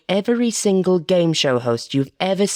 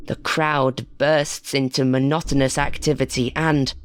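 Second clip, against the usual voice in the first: usual speaking voice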